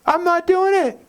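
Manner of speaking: high, pushed-out refusal; emphatic refusal. Retold or imitated. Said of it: "what if he would have said".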